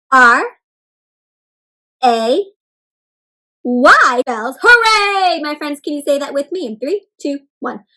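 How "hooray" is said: high, loud, stretched-out hooray; excited cheer. Shown as a demonstration. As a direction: cheer it out with joy. Cheers out the exclamation word.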